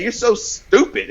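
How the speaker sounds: a light imitation of a mean classmate. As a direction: sneer it up high like a teen bully. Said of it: "the girl said it again".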